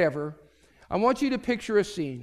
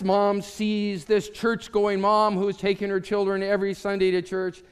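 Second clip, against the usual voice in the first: ordinary talk, nothing marked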